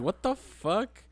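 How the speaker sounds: high, slow drawn-out disbelief; retold shock. Recalling his reaction to the second pregnancy, acting stunned. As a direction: stretch it out in mock astonishment. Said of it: "that was my reaction".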